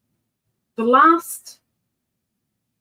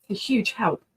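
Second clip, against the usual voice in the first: usual speaking voice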